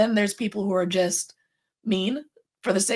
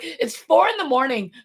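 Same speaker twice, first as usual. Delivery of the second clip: telling someone off; 7 semitones higher, 4 dB louder than usual. Retold or imitated. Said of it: "I had to tell someone to go to bed".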